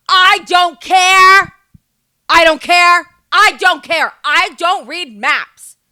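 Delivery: loud, high comic outburst; shouted rant. Acted. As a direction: yell it in exaggerated exasperation. Mock rant about maps and compasses.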